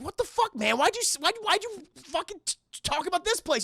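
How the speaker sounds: high, stammering, frantic protest; Sonny panicking at Steve for bringing them there; panicked outburst. Retold or imitated. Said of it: "Sonny's like".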